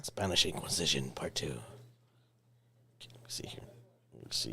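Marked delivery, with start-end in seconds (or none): 1.5-4.5 deep, hushed trailer narrator; low whispery trailer voice; movie trailer voice. Real, not acted. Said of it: "you sounded like a movie trailer guy".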